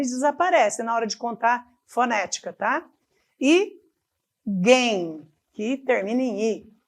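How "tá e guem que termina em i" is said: usual speaking voice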